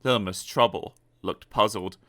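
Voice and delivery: ordinary delivery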